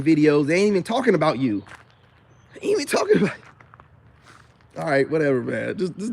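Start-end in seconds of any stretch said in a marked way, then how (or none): 2.5-3.4 much higher, mocking falsetto; squeaky mock echo of his own words; mocking high repeat. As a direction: toss the line back up high, teasing.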